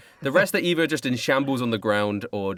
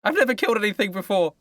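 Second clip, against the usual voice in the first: high, hesitant scout; scout character voice; 8 semitones higher than usual. Acted. Scout admits inexperience to the shark, high voice.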